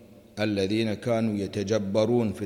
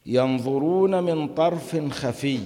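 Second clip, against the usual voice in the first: higher, slow chanted verse; verse recitation; 5 semitones higher, 3 dB louder, slower than usual. Acted. Teacher quoting the verse to students, slow chant. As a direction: draw the verse out, intoned.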